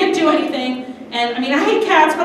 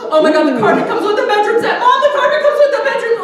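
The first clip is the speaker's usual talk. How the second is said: high, louder, fast, laughing; thrilled shopper squealing to mom; giddy over a bedroom set; excited gushing; 10 semitones higher, 6 dB louder, faster than usual. Retold or imitated. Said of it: "I'm like".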